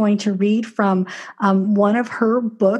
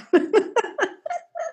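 bright laugh; 12 semitones higher, 3 dB quieter than usual. Real, not acted. Delighted after hearing reading.

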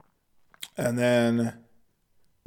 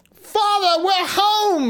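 calling out; much slower than usual. Acted. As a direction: call home like an eager boy. Hansel calls out to father.

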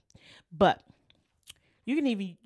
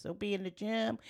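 normal talking voice

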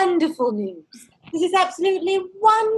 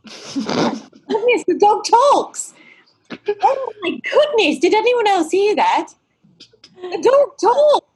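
high, loud astonished cries; shocked; 6 semitones higher, 4 dB louder than usual. Acted. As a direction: gasp it out in disbelief. Shocked the dog talks.

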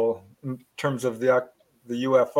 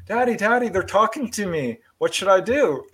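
put-on high, eager little girl calling Daddy; little daughter's voice; 6 semitones higher than usual. Little daughter calls to her dad, high and eager. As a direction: pitch it up like a small child asking for help.

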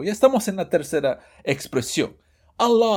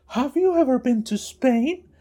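high, slow voice for the first speaker; high dialogue voice, first part; first of two voices; 10 semitones higher, 4 dB louder than usual. Shown as a demonstration. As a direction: pitch up for the question voice. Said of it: "De mis clásicas dos voces".

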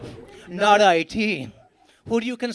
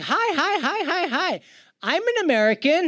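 mock American greeting; 7 semitones higher than usual. Fake American phone greeting.

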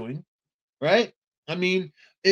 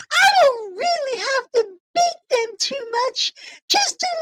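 much higher, louder, hammed-up gushing; old slave owner voice; 7 dB louder, slower than usual. Mocking old slave owners praising their servants, high and hammed-up. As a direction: ham up a cruel, gushing plantation master. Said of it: "Old slave owners talking".

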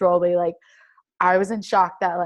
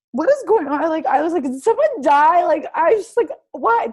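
high, loud, retold bewilderment; alarmed disbelief; 9 semitones higher, 6 dB louder than usual. Athlete retelling her confusion as texts flood in, alarmed. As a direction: re-enact panicked questions, pitched way up.

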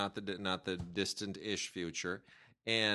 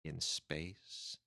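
low, hushed trailer read; movie trailer voice; 6 semitones lower, 8 dB quieter, slower than usual. Shown as a demonstration. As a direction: read it like a dramatic movie tagline. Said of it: "tell me what you think of this".

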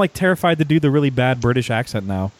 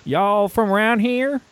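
higher, slow hammed-up Texas drawl; mock Texan accent; 10 semitones higher, slower than usual. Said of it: "I'm not like".

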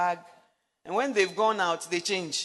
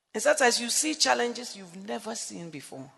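usual speaking voice

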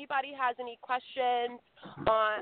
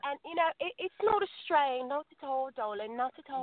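put-on British accent; 6 dB louder than usual. Guest shows she can switch to a British accent. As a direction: slip back into a posh British accent. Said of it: "let me go ahead and jump right back into it".

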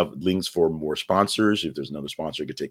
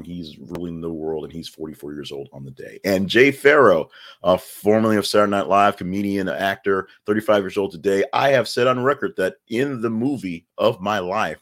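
normal talking voice

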